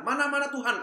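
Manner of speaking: a haughty, high demand; arrogant demand. Acted. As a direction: demand it like he's owed. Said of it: "sombong sekali".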